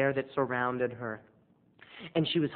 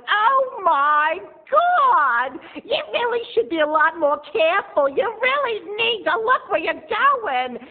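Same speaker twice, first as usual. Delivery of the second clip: shrill, very high and loud mock scolding; snooty scolding woman; 6 dB louder, slower than usual. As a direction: lecture him like a spoiled diva. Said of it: "And she's like".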